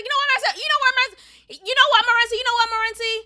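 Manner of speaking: loud, high-pitched mock yelling, repeated; imitated angry cutting-in. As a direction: mimic someone shouting over you to end the argument. Imitates him before he hangs up.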